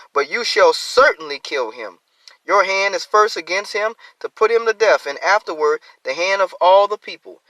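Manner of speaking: loud, lifted reading of the verse; scripture reading. Acted. Preacher reading scripture aloud to the congregation, loud and lifted.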